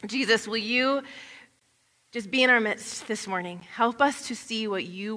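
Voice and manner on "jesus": ordinary delivery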